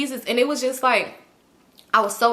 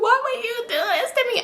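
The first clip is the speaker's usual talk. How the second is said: very high-pitched anguished wail; mock screaming; 14 semitones higher than usual. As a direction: squeal it as a hammed-up tearful plea. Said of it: "I'm just screaming".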